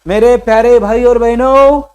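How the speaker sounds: high, booming rally shout; drawn-out orator's yell; loud speech shout. Shown as a demonstration. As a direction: bellow it to a crowd, stretching the last word. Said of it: "मोठ्या आवाजाचा किंवा ओरडू ओरडू बोलणे".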